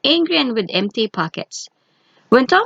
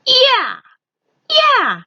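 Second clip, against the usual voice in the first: much higher, louder rider's yell; shout at horse; 5 dB louder than usual. A narrator's reading. Tom driving the cart round a corner, high loud yells. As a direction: call it out hard, urging the horse. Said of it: "Tom Thumb was shouting".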